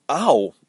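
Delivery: real startled ow; sudden yelp. Real, not acted. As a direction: yelp as if hurt.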